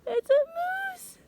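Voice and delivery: high, loud, slow amazement; gasp, then marvel at it; excited gasp. Real, not acted. Amazed at seeing the moose.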